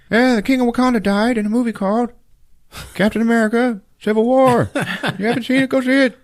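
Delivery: high, strained cartoon announcer; mock TV newscaster. TV news reporting the king's death, high mock announcer. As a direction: push it way up high like a spoof broadcast.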